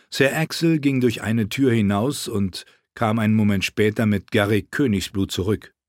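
usual speaking voice

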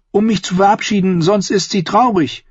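put-on high-pitched child insisting; high, urgent young boy; insistent boy. Boy explaining his wish to Davos, insistent. Boy pleading to visit princess.

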